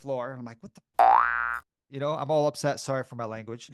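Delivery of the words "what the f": loud, slow, exasperated curse; mock outburst. On the floor after falling, a loud frustrated curse.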